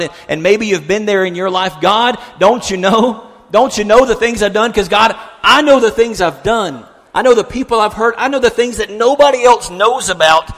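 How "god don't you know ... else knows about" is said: raised, higher-pitched plea to God; impassioned acted prayer; anguished confession. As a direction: cry out a confession, pressing harder.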